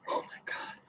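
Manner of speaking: hushed whisper, everyone asleep. Retold or imitated. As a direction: breathe it under the covers. Late night, everyone asleep.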